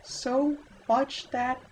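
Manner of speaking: hammed-up falsetto of a swooning village girl; high-pitched girly voice. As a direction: squeal it out like a lovestruck girl. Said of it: "my high-pitched girly voice".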